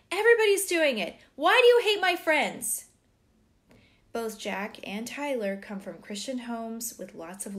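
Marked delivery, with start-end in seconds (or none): 0.1-2.8 put-on loud, high teenage protest; blurted outburst. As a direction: yell it defensively at your parents, fast and high. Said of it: "finally he blurts out".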